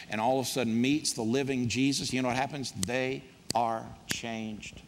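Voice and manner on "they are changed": slow, quiet and deliberate, each word weighed; slow solemn refrain. Real, not acted. Pastor closing each changed-life example, slow hushed refrain. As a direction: let the words sink in slowly.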